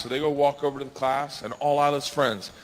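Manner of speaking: ordinary talk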